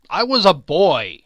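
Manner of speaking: impatient loud retort. A narrator's reading. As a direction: brush her off, fed up. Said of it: "he said impatiently".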